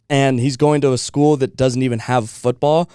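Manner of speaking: usual speaking voice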